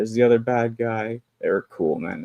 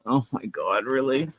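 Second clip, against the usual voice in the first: ordinary talk, nothing marked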